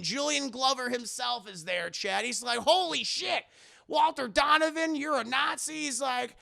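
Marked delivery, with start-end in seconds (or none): 2.6-5.7 high-pitched astonished question; shocked disbelief. Retold or imitated. Indy discovers Donovan's betrayal, high disbelief. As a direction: gasp the words in mock shock.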